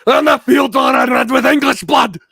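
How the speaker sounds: high, very loud shout; hammed-up bloodthirsty yell; intense battle roar. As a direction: bellow it like a warrior mid-battle. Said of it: "I'm gonna be so intense".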